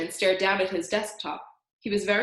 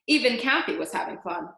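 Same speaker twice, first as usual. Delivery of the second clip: plain reading voice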